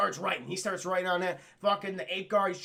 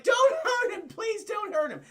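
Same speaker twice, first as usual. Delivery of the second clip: mock pleading; 6 dB louder than usual. Ape doctor begging guards to stop burning him, high mock plea. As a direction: beg the guards in a squeaky panic.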